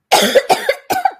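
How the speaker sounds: loud rising cough fit; hacking cough; chesty cough. Real, not acted. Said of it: "work through the tickle".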